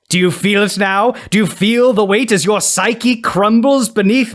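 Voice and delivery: theatrical vampire-like villain, slowing at the end; Mister Sinister. Acted. Villain gloats over his psychic attack, theatrical villain voice. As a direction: gloat grandly, savour each question. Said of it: "looks like a nineties kid's idea of a vampire".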